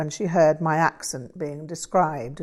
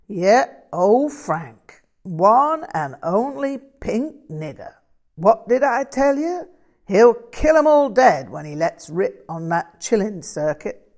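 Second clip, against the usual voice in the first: loud, higher-pitched drawling bluesman; big hyped-up American blues character voice; exuberant American bluesman; 5 semitones higher, 9 dB louder than usual. Acted.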